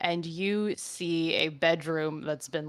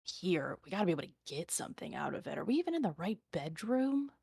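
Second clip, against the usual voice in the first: muttering; 9 dB quieter, much faster than usual. Acted. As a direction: mutter to yourself, annoyed. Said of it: "hear them muttering to themselves".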